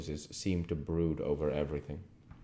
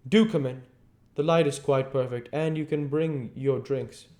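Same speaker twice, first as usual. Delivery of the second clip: higher, cheerful host voice; painter calling them in; 9 semitones higher, 4 dB louder than usual. Acted. Painter calling friends in from the garden, eager. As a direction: wave them inside, eager. Hallward calls them into the studio.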